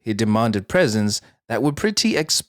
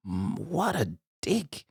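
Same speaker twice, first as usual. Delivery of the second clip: much softer, slow scornful murmur; disgusted aside; 10 dB quieter, slower than usual. Real, not acted. After reading his message, soft slow scornful murmur.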